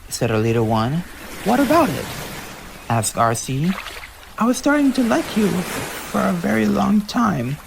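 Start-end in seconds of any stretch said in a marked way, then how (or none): 1.4-2.0 high, curious question; curious sister. Acted. Arcee asks back. 4.4-7.6 high, smiling admission; grinning confession. Acted. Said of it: "with a grin smile".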